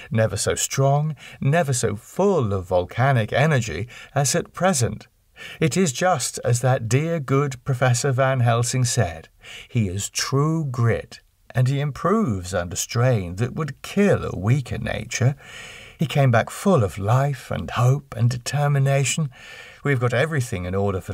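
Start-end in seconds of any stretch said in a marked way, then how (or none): none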